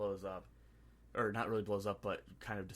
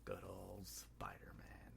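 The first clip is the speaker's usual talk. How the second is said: slow, hushed and sinister; slow sinister whisper; menacing whisper; 2 semitones lower, 12 dB quieter, much slower than usual. Retold or imitated. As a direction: breathe it like a villain's threat. Villain's menacing line, acted quote.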